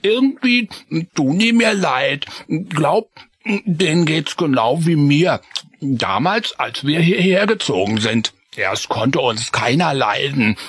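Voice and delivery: high mouse voice talking through cheese; lightly mumbled, friendly Freddy; mumbling mouse friend. Acted. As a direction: mumble with your mouth full. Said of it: "Schimmelkäse im Mund und nuschelte".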